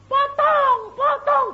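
very high, loud chanted yell; high-pitched imitated war cry; acted attackers' shout. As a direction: yell it like a mob calling for blood. Acts out attackers' death cries.

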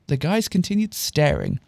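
plain reading voice

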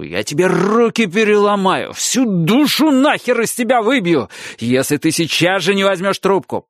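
high raging scream, building up; furious screaming. Acted. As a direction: rage rising to a scream. Father threatening, strangling son.

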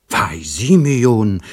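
langsamer, lauter zorniger Ausruf. A narrator's reading. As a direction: den Namen empört und gedehnt herausrufen. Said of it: "rief Lieschen zornig". (Lieschen weist Simeon zornig zurecht)

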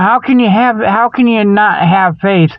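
ordinary talk

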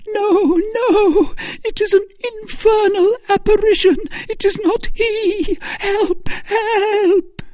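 very high, loud, fast panicked shrieks; Milady screaming in terror at the apparition; terrified screaming. A narrator's reading. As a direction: scream for help against the wall. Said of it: "screamed she".

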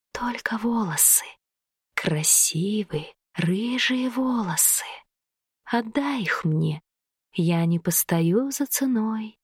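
low, slow hissing whisper; snake woman voice. A narrator's reading. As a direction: breathe each word out like a serpent. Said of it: "похожим на змеиное шипение".

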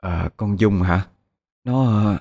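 slow, evasive hesitation; hemming and hawing. Acted. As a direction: drag it out, unsure what to admit. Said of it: "cái gì mà ấp a ấp". (ấp a ấp úng)

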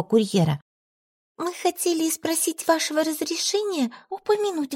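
a meek wife pleading; timid servant woman. A narrator's reading. Emilia voiced by narrator.

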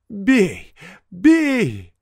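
high, loud defiant shout; fierce, wild cry; ferocious voice. A narrator's reading. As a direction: savagely dare him to strike. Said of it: "подхватил мужик свирепым голосом".

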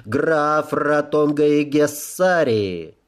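громкое торжественное объявление, медленно; провозгласить имя на весь зал; town crier. Acted. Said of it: "высокий звучный голос Герольда". (глашатай, медленно и звучно)

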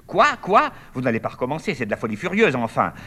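high, loud scandalised protest; outraged cry. Acted. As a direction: shriek at the brothers to stop. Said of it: "Les trois filles, presque en même temps". (protestation outrée, aiguë et forte)

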